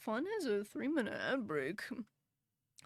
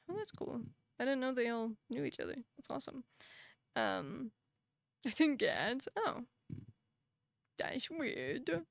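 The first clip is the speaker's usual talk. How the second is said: usual speaking voice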